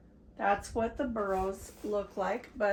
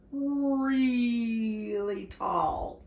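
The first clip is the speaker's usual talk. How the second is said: really drawn out very long; stretched emphasis; 4 dB louder, much slower than usual. Real, not acted. Reader marvels at the cactus height, "really" stretched right out. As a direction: hold the first vowel of "really".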